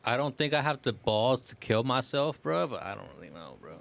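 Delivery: normal talking voice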